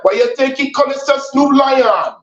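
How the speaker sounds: high, drawn-out Jamaican protest; hammed-up Jamaican accent, rising high; mock Jamaican accent. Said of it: "Jamaicans don't like that either".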